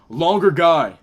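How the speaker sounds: ordinary delivery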